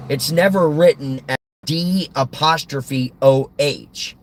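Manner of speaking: ordinary delivery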